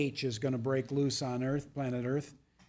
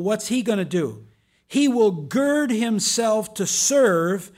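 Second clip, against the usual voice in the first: full-force preaching; 6 semitones higher, 7 dB louder than usual. Acted. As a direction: preach it out to the congregation with fire.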